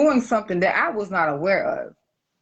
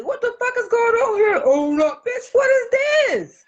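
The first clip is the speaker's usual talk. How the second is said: shrill outrage; 13 semitones higher than usual. Retold or imitated. Confronting the boyfriend's friend.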